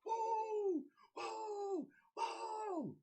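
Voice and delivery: high, drawn-out fan whoop; excited whoops. Real, not acted. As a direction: whoop it up for your team.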